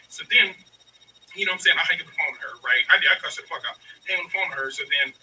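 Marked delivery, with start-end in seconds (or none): none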